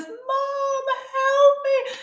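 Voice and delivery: very high, drawn-out mock cry for help; screaming for help. Son on the cross calling out to mom, screaming. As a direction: imitate a child wailing for mom, pitch way up. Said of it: "he is screaming, you know, for help".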